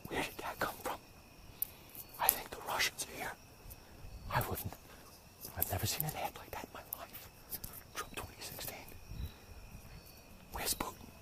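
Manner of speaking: hushed, secretive whisper; mock-paranoid whisper. Voter who saw the Trump ad, mock-paranoid whisper. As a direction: whisper as if the Russians might hear.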